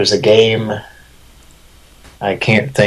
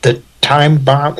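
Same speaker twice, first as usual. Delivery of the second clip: game announcer voice; 6 semitones higher than usual. Retold or imitated. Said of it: "I'd go".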